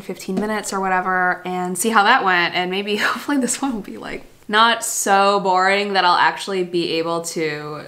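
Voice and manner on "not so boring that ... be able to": normal talking voice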